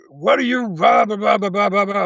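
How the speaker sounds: high-pitched run of blahs; mock ranting. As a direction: mimic her scolding in a high voice. Mimics woman ranting on phone.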